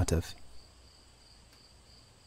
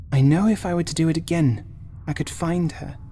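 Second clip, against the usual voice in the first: put-on higher, a touch tearful young woman; tearful Sadie; 8 semitones higher than usual. A narrator's reading. Sadie tearfully telling Oliver about Angela's voice, mild tearful. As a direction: speak through fresh tears, mildly. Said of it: "Fresh tears flowed down her face".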